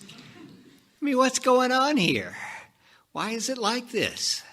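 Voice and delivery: high-pitched, incredulous complaint; exasperated protest. Acted. Speaker protests to the audience about dying, exasperated. Mock outrage at life ending.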